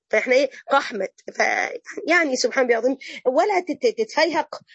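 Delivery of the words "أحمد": قحمد with a hard q in place of the opening a of أحمد; mock the qaf swap in قحمد; mocking mispronunciation. Teacher mocking people who overcorrect Arabic, jokey mispronunciation. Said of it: "طب هو أحمد".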